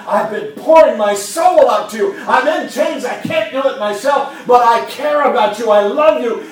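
loud, high impassioned plea; voicing Paul, pleading shout. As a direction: cry out your love from chains. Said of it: "Paul is saying".

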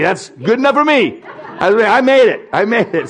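giddy, breathless joy at just scraping in; laughing relief. Pretend. Preacher imagining himself admitted to heaven, laughing with relief.

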